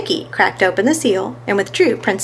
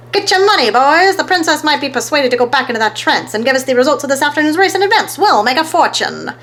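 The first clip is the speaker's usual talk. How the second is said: much higher, loud, fast huckster cry; greedy excitement; 6 semitones higher, 3 dB louder than usual. A narrator's reading. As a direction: whip the crowd into a frenzy. Fats waving bills, greedy.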